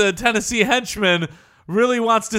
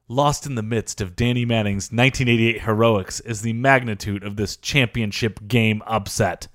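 documentary narration; 6 semitones lower than usual. Acted. Reading list narration.

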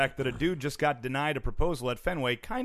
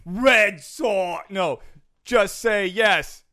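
slow, loud Boston crowd chant; Boston accent; 4 semitones higher, 6 dB louder, much slower than usual. Pretend. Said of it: "that's creepy with a Boston accent".

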